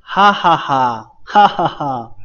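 哈哈哈 as loud, falling laugh syllables; demonstrating a big "ha ha ha"; mock big laugh. Shown as a demonstration. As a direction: read out 哈哈哈 like hearty laughter, loud and drawn out. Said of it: "大笑的声音啊".

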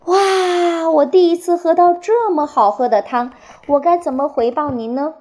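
high, delighted little hedgehog; 惊喜赞叹. A narrator's reading. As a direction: marvel at it, starting high on a long wow. Hedgehog delighted by first soup. (高音惊喜的小刺猬口吻)

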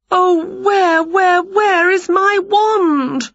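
high, loud, drawn-out lament; slow high-pitched theatrical wail. A narrator's reading. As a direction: wail in desperate frustration. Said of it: "wailed Winnie".

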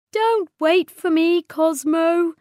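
Patty telling Cosmo to save himself, high and sad; sadly. Acted. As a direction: tell your friend to leave you behind. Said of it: "she said sadly".

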